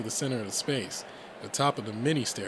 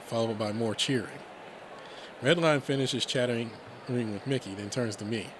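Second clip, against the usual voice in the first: plain reading voice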